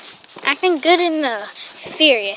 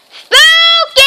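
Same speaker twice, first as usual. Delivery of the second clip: high, loud call; calling the dog; 16 dB louder than usual. Real, not acted. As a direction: yell the name.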